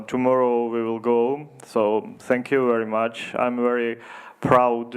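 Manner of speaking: ordinary delivery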